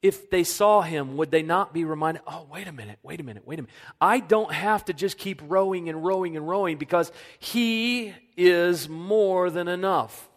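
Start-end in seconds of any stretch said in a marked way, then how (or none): 2.2-3.8 soft, hushed, catching yourself; hushed realization. Acted. Disciple in the boat, realizing, hushed dawning realization. 4.0-10.3 growing conviction. Acted. Disciple's realization continued.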